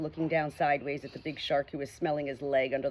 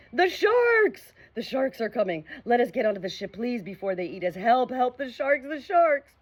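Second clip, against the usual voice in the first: high, loud terrified pirates; drowning pirates crying out for help in fear; panicked cry; 6 semitones higher, 3 dB louder than usual. A narrator's reading. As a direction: scream for help from the water. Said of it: "cried out in great fear".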